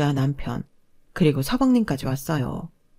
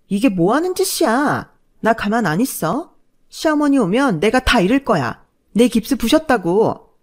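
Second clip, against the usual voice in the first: higher, louder furious protest; furious outburst; 4 semitones higher, 5 dB louder than usual. Acted. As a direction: shriek out your outrage. Sister-in-law rages over broken cast.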